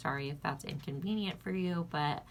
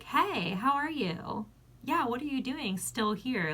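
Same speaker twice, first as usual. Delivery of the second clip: fake friendly greeting; 6 semitones higher than usual. Running into him at PetSmart.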